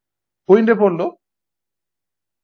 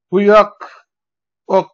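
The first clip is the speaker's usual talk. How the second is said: walk punched louder, then repeated alone; stress on walk; 3 semitones higher, 4 dB louder, much slower than usual. Shown as a demonstration. Teacher marking the stress on walk for students. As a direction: hit the word walk. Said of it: "walk-এর উপরে হচ্ছে stress mark পড়বে".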